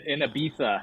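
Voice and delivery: Ibiza said as Ibitha with a lisped th; the z in Ibiza as a soft th, Spanish style. Shown as a demonstration. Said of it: "even threw in the Spanish lisp".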